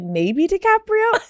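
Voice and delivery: high, drawn-out mock tentativeness; imitating unsure answer. Host teasing guest's answer about her phone, mock unsure. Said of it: "you're not even sure".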